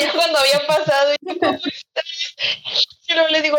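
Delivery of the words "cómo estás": tag. Retold or imitated. Retells own panicked call.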